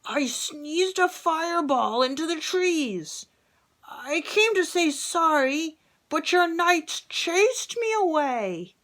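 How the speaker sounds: dragon apologising. Acted. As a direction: plead his side as the dragon. Dragon explains himself.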